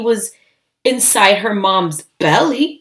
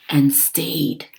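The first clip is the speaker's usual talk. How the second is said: whisper; 6 semitones lower, 7 dB quieter than usual. A narrator's reading. Repeated phrase fading to whisper.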